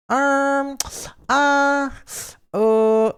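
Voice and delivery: high, loud stammering ums; squeaky embarrassed hesitation; flustered. Real, not acted. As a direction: caught off guard, pitch shoots up. Flustered by moaning question.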